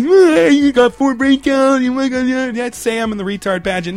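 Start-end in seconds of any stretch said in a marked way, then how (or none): 0.0-2.5 slow, louder, higher dopey voice; slow hammed-up dopey voice; mock dim voice. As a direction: drag it out like a dimwit.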